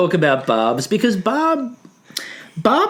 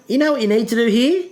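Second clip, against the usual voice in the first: quoting Mel; 8 semitones higher than usual. Retold or imitated. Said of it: "As Mel said".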